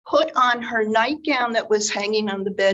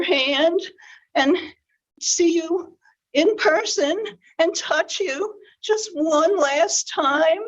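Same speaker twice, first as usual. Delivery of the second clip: wolf's grandma voice; 7 semitones higher than usual. Acted. Wolf in bed fooling Red, fake old granny voice. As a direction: fake a sickly granny to lure her in. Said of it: "in his best grandma voice".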